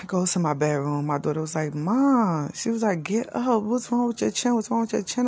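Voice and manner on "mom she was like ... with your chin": put-on worried child voice. Daughter voiced in story.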